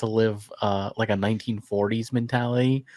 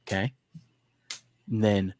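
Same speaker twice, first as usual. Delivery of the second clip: usual speaking voice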